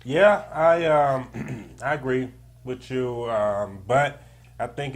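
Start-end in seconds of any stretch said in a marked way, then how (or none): none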